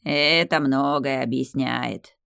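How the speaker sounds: very slow understanding drawl; slow knowing tree drawl; drawn out knowingly. Acted. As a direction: stretch the words, nodding along. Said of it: "понятливо протянуло дерево".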